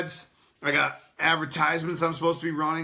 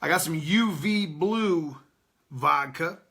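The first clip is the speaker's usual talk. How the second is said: slow, every word drawn out; real slow; slower than usual. Shown as a demonstration. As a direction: say it again slowly so nobody misses it. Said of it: "I'm gonna say that again real slow".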